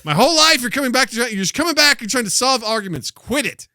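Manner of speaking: high, heated mock rant; exasperated scolding. Past self fed up with time-travel visits, high exasperated scolding.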